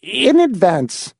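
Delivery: put-on high, slow, stingy old voice; name the price, miserly; grasping old man. A narrator's reading. The little man charges the visitors, high and miserly. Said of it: "he added".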